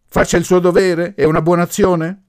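high, loud challenging questions; prompt retort. A narrator's reading. Professor completing the mother's sentence, sharp retort. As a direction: jump in and finish the sentence. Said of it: "domandò pronto il Gori, compiendo lui la frase".

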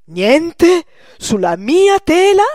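high, slow, loud cry of disbelief; stunned old painter crying out, loud and high; outraged disbelief. Acted. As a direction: howl it out in shock.

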